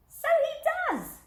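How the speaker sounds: very high, slow squeaky little skeleton. Acted. Little skeleton agreeing the dog looks wrong, very high squeak. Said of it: "said the little skeleton".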